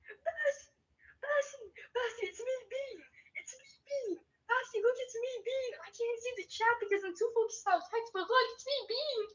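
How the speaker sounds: very high, soft squeaky little voice; squeaky Bean voice. Pretend. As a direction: squeak like a tiny excited creature. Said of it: "my voice acting".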